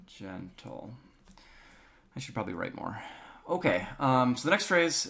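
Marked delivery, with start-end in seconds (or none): 0.1-0.9 a soft, breathy-light gentle. Shown as a demonstration. Teacher softly models the gentle accent. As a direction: murmur the word gentle softly. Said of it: "Kinda gentle on the accent".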